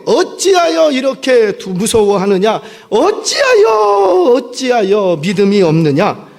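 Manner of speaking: drawn-out, rising shout of rebuke; Jesus rebuking his disciples; scolding. Acted. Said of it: "지금 꾸짖는 거예요".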